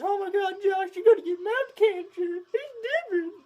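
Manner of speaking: very high, loud mock-hysterical wail; imitation of a frantic squealing grandma; grandma freaking out. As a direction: shriek it like a panicking grandma, hammed-up. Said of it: "she started freaking out".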